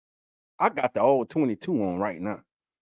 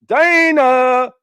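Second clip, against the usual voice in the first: much higher and louder, a long called-out name; calling out; 11 semitones higher, 8 dB louder than usual. Real, not acted. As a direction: greet her like she just walked through the door. Greeting viewer joining the stream.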